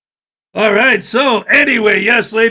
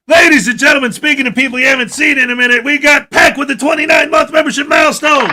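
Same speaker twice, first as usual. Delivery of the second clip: high, loud ringside-style announcement; hype announcer; 7 semitones higher, 5 dB louder than usual. Acted. Host announcing a member's milestone to viewers, hype announcer. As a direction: belt out the big milestone news.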